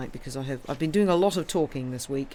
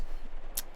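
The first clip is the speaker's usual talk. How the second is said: soft breathy sigh of bliss; contented sigh; 5 dB quieter than usual. Real, not acted. Thinking of jigsaws, a soft contented sigh. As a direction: let out a dreamy sigh.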